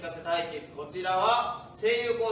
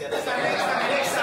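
full throttle; 7 semitones higher, 6 dB louder than usual. Shown as a demonstration. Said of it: "エンジン全開です".

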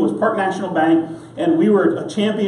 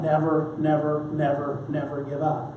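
each never softer, trailing down to near quiet; fading plea; 3 semitones lower, 8 dB quieter than usual. Acted. Preacher urging the congregation, fading to hushed. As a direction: let it fade to an earnest hush.

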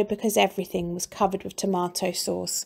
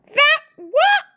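terrified scream; 7 dB louder than usual. A narrator's reading. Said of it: "screamed Missus Twit".

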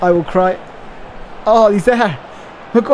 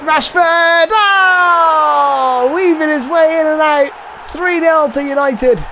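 excited commentary shout; 6 semitones higher, slower than usual. Real, not acted. Gamer calling Rashford's goal, excited shouting. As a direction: call the goal like a thrilled commentator. Goal scored in his game.

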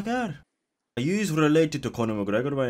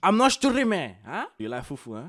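higher, exaggerated West African denial; put-on West African accent; 5 semitones higher, 4 dB louder than usual. Streamer denying he is streaming, exaggerated West African accent. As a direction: protest innocence in a mock West African accent.

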